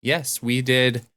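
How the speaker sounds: ordinary talk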